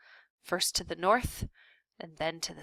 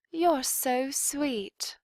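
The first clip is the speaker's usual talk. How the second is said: high, slow and sweet princess; 7 semitones higher, much slower than usual. A narrator's reading. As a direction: coo it slowly like a fond princess. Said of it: "said the princess to Despero".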